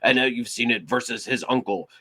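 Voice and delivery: ordinary talk, nothing marked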